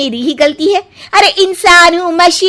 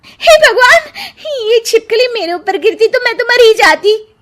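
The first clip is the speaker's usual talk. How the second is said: frightened; 7 semitones higher than usual. Acted. Shuchi nearly hit by a falling lizard, frightened cry. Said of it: "शुचि छिपकली देखकर डर जाती है।".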